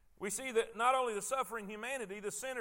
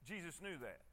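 softer and lower repeat; much softer, lower; 6 semitones lower, 12 dB quieter than usual. Shown as a demonstration. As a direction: drop the voice on the repeat.

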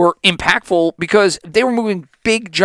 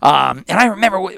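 normal talking voice

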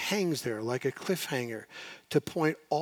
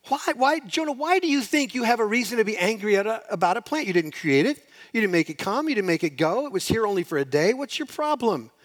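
higher, louder voice of God questioning; God pressing Jonah; 7 semitones higher, 4 dB louder than usual. Retold or imitated. God confronting sulking Jonah, higher and louder. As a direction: press him with each question. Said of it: "you can almost hear God say".

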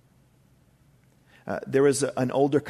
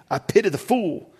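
high, loud tough-guy impression; hammed-up tough-guy catchphrase; Mister T; 7 semitones higher, 5 dB louder than usual. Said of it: "I love the Mister T".

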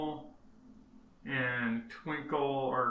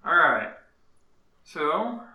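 normal talking voice

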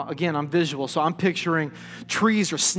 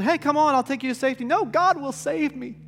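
high, calling offer and stubborn refusal; joke-character voices; 8 semitones higher than usual. Acted. Helicopter offers rescue, castaway refuses, joke-character voices. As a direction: act out both sides, pitched up.

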